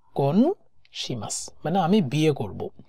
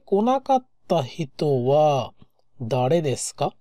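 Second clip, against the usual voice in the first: ordinary delivery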